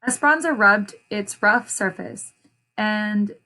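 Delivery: plain reading voice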